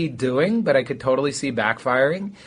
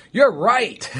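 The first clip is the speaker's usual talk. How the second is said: high and laughing agreement; 7 semitones higher than usual. Retold or imitated. Quoting own habitual reply.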